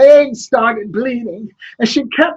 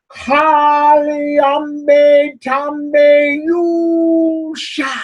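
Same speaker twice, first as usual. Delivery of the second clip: slow, drawn-out chanted spell; magic incantation; 5 dB louder, much slower than usual. Acted. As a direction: intone the words like an ancient spell. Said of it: "he started speaking the magic words".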